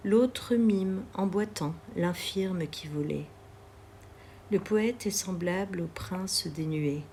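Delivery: plain reading voice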